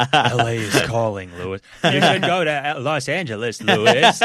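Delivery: loud, high, drawn-out mimicry; Matt Maron. Comic mimicking Matt coaxing Lewis to move, hammed-up impression. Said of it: "Matt Maron impressions".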